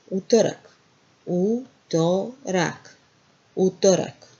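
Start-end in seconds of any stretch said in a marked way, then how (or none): 1.3-3.0 drawn out slowly, syllable by syllable. Shown as a demonstration. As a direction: stretch Utorak out for the class. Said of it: "Then what we have here?".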